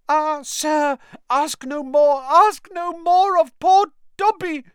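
high, loud, pleading elf voice; stammering elf. Acted. Dobby begging Harry to stop asking, high and stammering. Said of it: "stammered the elf".

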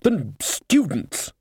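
high, slow, halting indignation; spluttering outrage. A narrator's reading. Wizard outraged at the uproar.

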